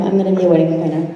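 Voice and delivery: dropped low and rattled off fast, mocking her old self; mock stubborn refrain. Mocking her younger self's stubborn refusal, fast and low. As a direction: toss it off like a tired excuse.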